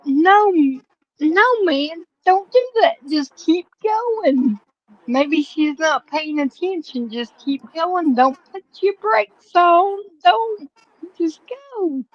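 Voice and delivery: high-pitched, emphatic retold urging; urgent pleading. Watching him put his brakes on, urgent pleading.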